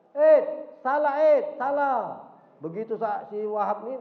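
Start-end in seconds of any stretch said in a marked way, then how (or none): 0.1-2.3 calling out loud from the doorway, a bit higher; shouting from the door. Acted. Wahab shouts from the doorway to Said, loud. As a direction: yell it in from outside the door. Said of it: "si Wahab berteriak".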